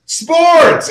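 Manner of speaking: high, drawn-out sports host call; mock radio host going high. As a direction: ham up a radio host's intro. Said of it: "and Scott goes".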